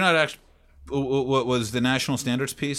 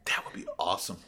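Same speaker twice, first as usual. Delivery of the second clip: whisper; 6 semitones lower, 8 dB quieter than usual. Real, not acted. Wistful aside after milk fantasy.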